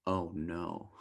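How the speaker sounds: a small dismayed groan of words; mock dread. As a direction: sink with sudden dread. Partner says we need to talk.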